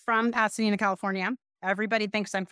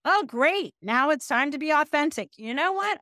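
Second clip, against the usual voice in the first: mock snarky outburst; 6 semitones higher than usual. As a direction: fake a blunt friend dropping all politeness. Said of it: "that is going to be like".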